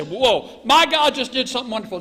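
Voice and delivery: high, loud, amazed burst; mock elation. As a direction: exclaim it as if thrilled. Voicing an elated Elijah, hypothetically.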